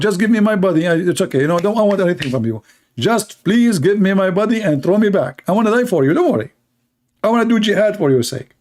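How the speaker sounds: eager, wheedling plea; desperate begging. Retold or imitated. Martyr begging God to send him back, pleading. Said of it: "You want".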